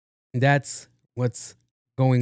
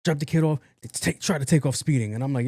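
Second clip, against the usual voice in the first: ordinary talk, nothing marked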